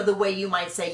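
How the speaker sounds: ordinary talk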